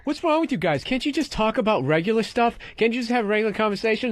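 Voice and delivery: high whiny mock of Joe, pleading. Friend mocking Joe's plea for normal talk, high whiny. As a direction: squeak it out like a baffled nerd. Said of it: "Joe goes like this".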